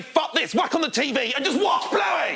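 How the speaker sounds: very high, strained comic shout; exasperated parent outburst. Acted. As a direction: give up on parenting at full pitch. Acted parents giving up, ranting.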